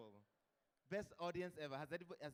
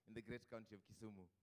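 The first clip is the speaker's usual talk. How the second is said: ordinary talk, nothing marked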